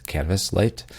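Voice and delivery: usual speaking voice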